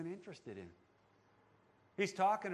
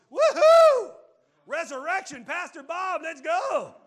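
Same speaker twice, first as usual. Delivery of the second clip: whooping cheer, much higher and louder; mock cheering; 9 semitones higher, 14 dB louder than usual. Congregation cheering the resurrection news, whooping loudly. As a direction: celebrate like it's a party. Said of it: "how many of you would be going".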